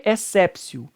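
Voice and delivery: exceptio with T as S, "essépsio"; correct Latin pronunciation. Shown as a demonstration. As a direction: exceptio pronounced "ek-SEP-sio", measured. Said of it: "o T tem som de C".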